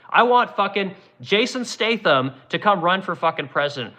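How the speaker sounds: ordinary delivery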